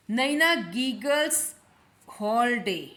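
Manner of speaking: slow, deliberate teacher dictation; slow example reading. Shown as a demonstration. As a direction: read the model sentence slowly, word by word. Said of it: "teacher reads example sentence".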